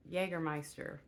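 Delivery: normal talking voice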